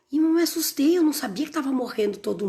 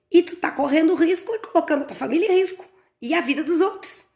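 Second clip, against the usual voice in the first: scolding wife; 7 semitones higher than usual. Retold or imitated. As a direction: scold him like a fed-up wife. Wife scolds her husband. (esposa brava dando bronca, bem aguda)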